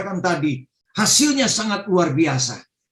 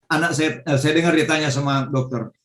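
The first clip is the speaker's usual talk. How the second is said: normal talking voice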